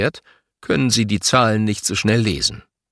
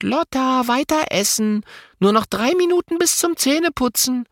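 mimicking mum. Girl mimics mother's nagging.

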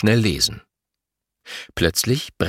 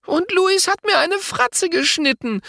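tattling. A narrator's reading. Girl tells on brother.

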